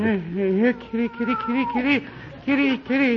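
high sing-song kitty call; coaxing the cat. Acted. Druggist coaxing the cat over, high and sing-song. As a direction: lure the cat gently.

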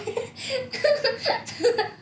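embarrassed giggle after singing; a bright breathy giggle. Real, not acted.

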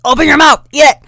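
loud, high-pitched yell; mock shouted order. Big sister ordering little sister to try an oyster, mock yell. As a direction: act out a bossy shouted command.

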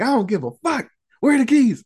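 acted fury, climbing in pitch and volume; exasperated demand. Acts armed woman insisting on keys.

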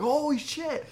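high-pitched ecstatic fan outburst, retold; going crazy. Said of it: "fucking get crazy and everything".

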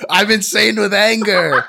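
high, slow mock rage; awkward attempt at cursing. Host quoting the priest trying to curse, high mock anger. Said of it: "he's, like, trying to curse".

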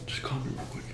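hushed whisper into the phone. Real, not acted. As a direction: whisper so the phone can't hear. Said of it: "Why are you whispering".

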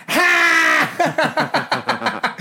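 high, loud "Ha!" burst; sharp high shout; loud retold cry. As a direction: let out a sudden high, loud yelp. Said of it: "I was just went like".

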